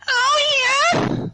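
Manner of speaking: very high, loud anime squeal; overacted anime dub. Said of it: "I have to sound like an anime character".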